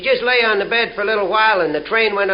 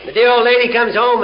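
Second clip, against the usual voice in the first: ordinary talk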